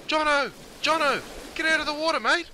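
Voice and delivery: high-pitched urgent call across the water; high, frantic shout-out; alarmed calling out. Acted. Acted warning of a shark.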